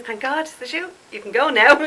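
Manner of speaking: high, laughing joke at the viewers; teasing laugh. Real, not acted. Vlogger jokingly dismissing viewers, high and laughing. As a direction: playfully shoo them off, laughing.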